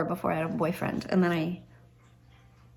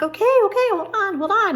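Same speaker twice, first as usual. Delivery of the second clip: much higher, louder, frantic hurry; fumbling with the lock while dad pounds, higher and louder; flustered panic; 12 semitones higher, 5 dB louder, faster than usual. Retold or imitated. Teen fumbling with the deadbolt, frantic and much higher.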